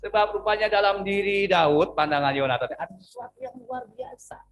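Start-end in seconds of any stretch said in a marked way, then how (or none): 2.7-4.4 soft, trailing delivery of the line; muted, almost thrown away; quiet first take. Shown as a demonstration. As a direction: let it fade away quietly. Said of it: "Oke, saya ulang".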